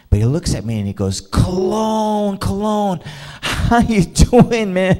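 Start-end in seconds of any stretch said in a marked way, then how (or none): 1.2-5.0 high, drawn-out mock greeting; hearty greeting. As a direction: call out a big hello, stretching the words. Pastor's hearty greeting, retold.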